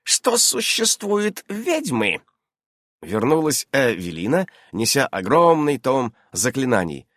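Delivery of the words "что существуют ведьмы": high, drawn-out question; deliberate, pensive witch voice; slow and thoughtful. A narrator's reading. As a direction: ask it ponderingly, stretching the words. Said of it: "медленно и задумчиво проговорила она".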